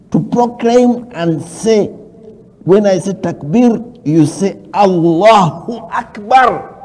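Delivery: high, loud, drawn-out call to proclaim; loud proclamation. Real, not acted. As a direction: proclaim it slowly at full voice. Preacher calls on viewers to proclaim takbir.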